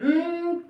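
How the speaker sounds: a single high hum; one short closed-mouth beat; humming one syllable. Shown as a demonstration. Teacher humming meet once for students. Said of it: "एक बार हम किया है".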